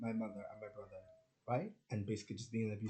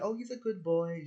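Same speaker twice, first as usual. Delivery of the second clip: imitated doting mom; 9 semitones higher, 7 dB louder than usual. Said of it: "she doesn't say, like".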